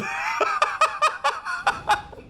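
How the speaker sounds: long high delighted laugh; high cackle. Real, not acted. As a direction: cackle with joy. Delighted by damage.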